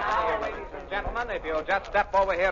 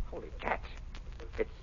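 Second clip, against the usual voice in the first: shocked whisper; 12 semitones lower, 16 dB quieter than usual. Acted. Discovers the body is real.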